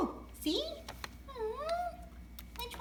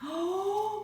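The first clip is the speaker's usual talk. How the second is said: high, bouncy voice for a pet bird; sing-song pet talk; 8 semitones higher, 7 dB louder, slower than usual. Acted. Owner cooing to her parrot about its new toy.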